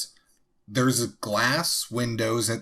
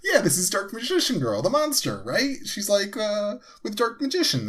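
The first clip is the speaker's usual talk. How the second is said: mock eager explainer; 11 semitones higher than usual. S1 mimics Devin explaining the card.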